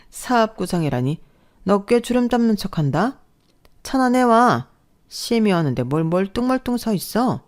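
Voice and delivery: mock her plans, then bark for tea; mocking mother-in-law. Mother-in-law mocking daughter-in-law's business plans, sneering and bossy.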